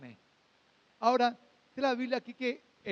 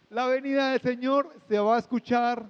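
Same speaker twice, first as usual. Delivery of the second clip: ordinary talk, nothing marked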